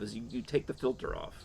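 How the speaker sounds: normal talking voice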